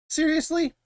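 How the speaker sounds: sharp high-pitched disbelief; incredulous. Real, not acted. Reacting to the villain's stupidity, incredulous. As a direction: ask it like you can't believe it. Disbelief at coke before rival.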